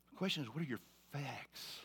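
unvoiced, intimate question; whisper. Real, not acted. Preacher whispering the key question to his congregation. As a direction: drop to a hushed whisper to make the point.

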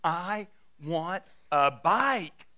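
put-on very slow, stubborn toddler demand; imitated three-year-old digging in; demanding little girl. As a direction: drag out each word like an insistent child. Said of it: "She says".

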